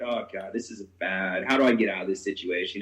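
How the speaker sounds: low, sinking inner monologue; understated low-voiced dismay; retold dread. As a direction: think aloud with a weary sense of trouble. Voices his worried thoughts.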